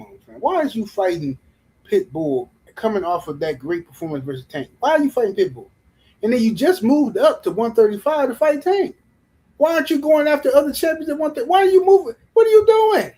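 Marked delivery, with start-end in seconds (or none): 4.8-13.1 building exasperated rant. Real, not acted. Ranting at a fighter's choices, climbing to a shout. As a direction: voice climbs higher and louder with each question until shouting.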